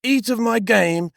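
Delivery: hammed-up impostor son; Jacob posing as Esau.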